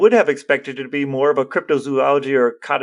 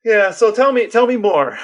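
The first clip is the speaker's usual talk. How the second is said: higher and louder, eagerly pressing for more; eager prompting; 8 semitones higher, 5 dB louder than usual. Real, not acted. Cohost urging his partner to share what he found, eager and higher. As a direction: push him to spill the story, keen and bright.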